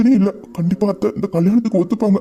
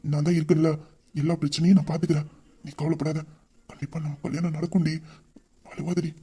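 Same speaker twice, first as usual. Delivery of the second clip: much softer, gentle reassurance; tender comfort; 4 semitones lower, 9 dB quieter than usual. Acted. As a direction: soothe her softly so she stops crying. Comforting crying fiancée. (மென்மையான ஆறுதல் குரல்)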